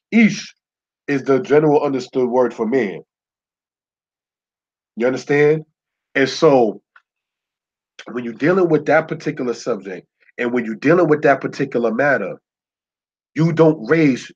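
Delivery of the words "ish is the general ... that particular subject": ordinary delivery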